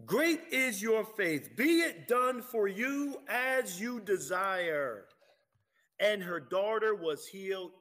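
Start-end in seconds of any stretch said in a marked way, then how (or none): 0.0-5.1 slow proclamation. Acted. Jesus's words, proclaimed boldly.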